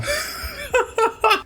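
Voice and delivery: risada bem aguda e solta. Real, not acted. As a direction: solte uma risada fininha. Laughs after wishing for easy life. (risada real, muito aguda)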